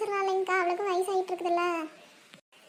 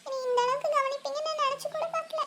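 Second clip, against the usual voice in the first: higher, choked-up voice close to tears; tearful bride overwhelmed by the family's kindness, voice breaking; tearful thanks; 6 semitones higher than usual. Acted. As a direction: thank her through happy tears. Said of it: "அந்த பிள்ளை ஏன் இப்படி அழுகுது?".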